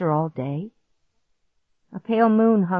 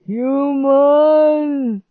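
high, loud, very slow strangled voice; choking monster; 8 semitones higher, 6 dB louder, much slower than usual. A narrator's reading. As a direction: force the words out, choking. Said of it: "he choked out".

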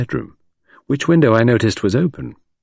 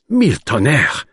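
cried out; 3 semitones higher than usual. A narrator's reading. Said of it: "cried Poirot".

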